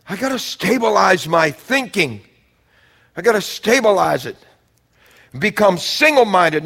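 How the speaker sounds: loud, high, driving sermon climax; full-force preaching. Acted. Preacher urging the church to cleanse themselves, loud and high. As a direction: preach it out hard to the congregation.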